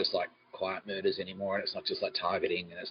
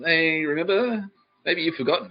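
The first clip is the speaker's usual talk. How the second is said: higher, slow taunting; mock sing-song taunt; 9 semitones higher, 6 dB louder than usual. Imitating the enemy's smug reminder, higher and slow. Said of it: "turns up to the fucking".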